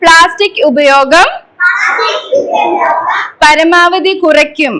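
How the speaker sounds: slow, projected recitation in short phrases; leading a pledge. Shown as a demonstration. Teacher leading children in an environment pledge, slow measured call-out. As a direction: call out each phrase firmly, pausing for the echo.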